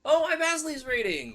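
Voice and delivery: bright, loud, high hello; a loud, high hello to a new viewer; excited greeting. Real, not acted. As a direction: call out hello with delight. Greets a viewer arriving on stream.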